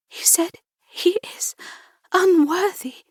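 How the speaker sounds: high, halting whisper; whispered disbelief. A narrator's reading. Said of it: "I whispered in disbelief".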